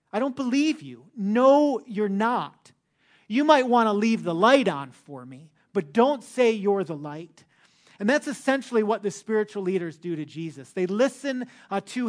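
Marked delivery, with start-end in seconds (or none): 0.1-1.1 the repeat pitched higher with scorn; scoffing disbelief. Retold or imitated. Skeptics in the temple scoffing at Jesus, higher mocking repeat. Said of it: "some people that simply say". 1.2-7.4 louder sarcastic jab, pitched up on "No"; mocking retort. Crowd member mocking Jesus's claim, louder sarcastic jab. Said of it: "some people that simply say".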